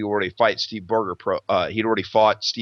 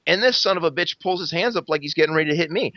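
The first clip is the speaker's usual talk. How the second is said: ordinary talk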